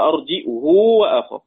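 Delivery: "أرجئه وأخاه" with glottal stop and long "huu"; hamza, lengthened damma. Shown as a demonstration. Teacher summing up Ibn Kathir's reading, "أرجئه وأخاه" as arji'huu. قصر ولا إشباع هنلاقيه إشباع.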